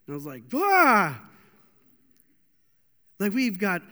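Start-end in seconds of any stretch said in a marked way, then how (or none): 0.5-1.2 loud "Ah!" swooping high then falling; sudden high, loud outburst of exasperation; exasperated cry. Retold or imitated. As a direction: blurt out a mock-exasperated cry. Exasperated reaction to denomination remark.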